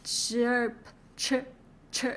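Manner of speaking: CH from "Chirp", stopping before the R; chirp cut short. Shown as a demonstration. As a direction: say "Chirp", then only its voiced CH start. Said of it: "right before you hit the R sound, stop there".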